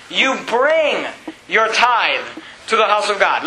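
"bring" drawn out with a falling tune; stress on bring. Shown as a demonstration. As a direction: lean on "bring", pause after it. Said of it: "Let me emphasize this word".

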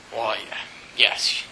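put-on soft old lady. Elderly lady answers the pastor.

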